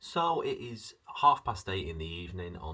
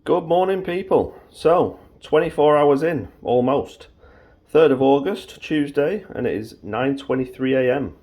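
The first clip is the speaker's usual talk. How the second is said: much louder and higher bright greeting; loud cheery morning; 10 semitones higher, 19 dB louder than usual. Real, not acted. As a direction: boom out a cheerful good morning.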